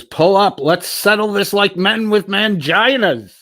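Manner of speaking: loud, high, hammed-up all-caps yell; all caps shouting. Reading a chat message aloud as an all-caps shout. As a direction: bellow the challenge at top volume. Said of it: "all caps".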